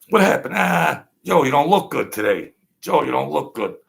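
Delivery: surprised at how sick he looks; loud concerned friend. Acted. Skit friend exclaims.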